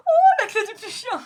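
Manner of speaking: very high, very loud mock excitement; hammed-up shriek imitating the anime; mimicked anime exclamation. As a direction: squeal it like an over-excited cartoon character. Mimicking the clip just played.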